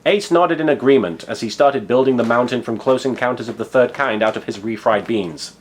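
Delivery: ordinary talk, nothing marked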